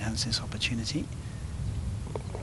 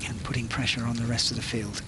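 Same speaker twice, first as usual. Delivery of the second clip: whispering. Real, not acted. Hushed golf commentary.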